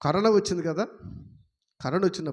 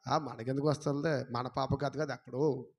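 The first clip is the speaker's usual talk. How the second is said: mock indifference; 6 semitones lower, 10 dB quieter than usual. Voicing careless listeners.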